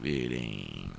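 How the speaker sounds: low silly voice. Pretend. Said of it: "trying out all your different voices".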